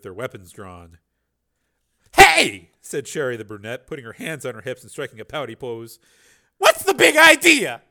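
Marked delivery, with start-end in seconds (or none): 2.2-2.7 startled shout. Acted. Woman protests officers bursting in. 6.6-7.8 pouty protest. Acted. Pouty dancer challenges officers.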